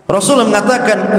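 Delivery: ordinary delivery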